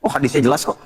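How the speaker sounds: ordinary delivery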